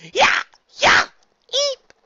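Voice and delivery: short, loud yells, then high eep; two sharp attack yells and a squeak; battle cries. Acted. Attendants pouncing on Luna, yelling, then squeaking.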